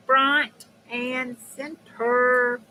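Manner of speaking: very slow, each word stretched; drawn-out announcement. Real, not acted. Streamer repeating a cheer to viewers, slow and drawn out. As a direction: stretch out FRONT and CENTER.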